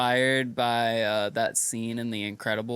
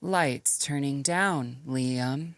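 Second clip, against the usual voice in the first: very slow, higher robotic AI voice; feminine robot voice; 3 semitones higher, much slower than usual. Pretend. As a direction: deliver it like a measured female robot. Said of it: "kinda robot voice I've been working on".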